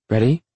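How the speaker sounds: READY lifted high with a rising end; questioning. A narrator's reading. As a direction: ask "Ready" in a high voice, sliding upward. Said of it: "she questions".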